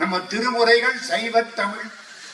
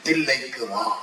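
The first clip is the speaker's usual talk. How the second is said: low, solemn call from above; voice from the sky; 6 semitones lower than usual. Acted. The heavenly voice calls the saint to Thillai, low and solemn. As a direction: boom it down like a divine command. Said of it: "மேல அசரீரி வாக்கு".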